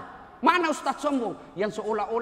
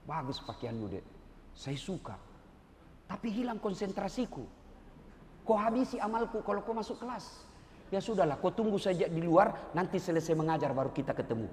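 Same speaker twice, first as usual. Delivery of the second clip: soft, low kind rebuke; softly sending a student out; gentle teacher; 5 semitones lower, 5 dB quieter than usual. Retold or imitated. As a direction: correct her kindly, quietly. Ustadz gently voicing himself to student.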